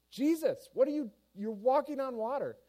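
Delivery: high, loud astonished cry; amazed disciples. Acted. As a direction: call out in disbelief, pitched high. Disciples astonished to see Jesus.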